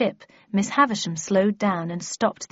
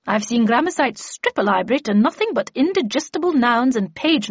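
emphatic, higher lecturing lady; lively, raised expert voice; Miss Havisham; 4 semitones higher, 5 dB louder than usual. Acted. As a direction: instruct with brisk, ringing authority. Miss Havisham explaining in character.

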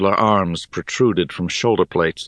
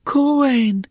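high, startled character cry; surprised greeting. A narrator's reading. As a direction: call the name in high-pitched surprise. Rhea greets Kuwain in surprise.

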